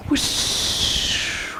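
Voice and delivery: long airy whoosh with a drawn-out sh; imitated rushing sound; whoosh noise. A narrator's reading. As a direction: let the word rush out as wind. Imitating a magical whoosh in narration.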